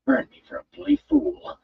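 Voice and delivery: put-on young man leaping into a fight, frantic; frantic thought. A narrator's reading. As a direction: curse yourself mid-leap. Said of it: "that one frantic thought".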